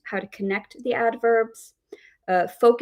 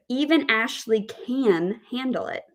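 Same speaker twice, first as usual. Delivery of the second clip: CAN raised and held; stress on CAN; 3 semitones higher than usual. Shown as a demonstration. Teacher shows the third example, stress on CAN. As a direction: lean on CAN, then let it fall. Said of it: "we have the word stress on can".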